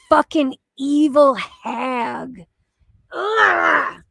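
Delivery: usual speaking voice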